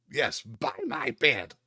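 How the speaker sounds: put-on old wizard voice.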